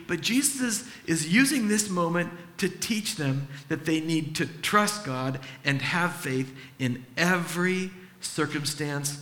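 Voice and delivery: usual speaking voice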